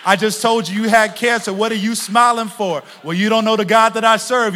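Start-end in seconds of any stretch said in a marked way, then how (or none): none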